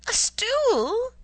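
shrill high loud squeal. A narrator's reading. Husband squealing at wife's request for a stool. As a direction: squeal it in outrage. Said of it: "squealed Semyon Semyonovitch".

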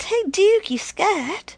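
high, loud jeering call; taunting. A narrator's reading. Kids calling out to Duke, taunting.

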